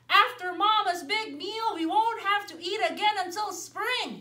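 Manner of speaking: full after dinner, ready for winter; big bear satisfied; sleepy bear. Acted. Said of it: "he said".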